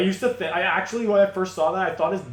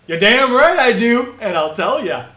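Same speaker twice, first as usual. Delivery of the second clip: eager boast; 6 semitones higher, 9 dB louder than usual. Acted.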